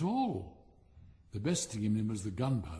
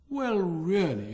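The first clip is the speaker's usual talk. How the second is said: exclamation; 5 semitones higher, 7 dB louder, slower than usual. A narrator's reading. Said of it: "exclaimed a small fire balloon".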